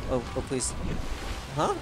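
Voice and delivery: ordinary delivery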